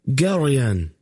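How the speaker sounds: high sharp call; exclaimed. A narrator's reading. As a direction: exclaim to get his attention. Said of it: "Laldoran exclaimed".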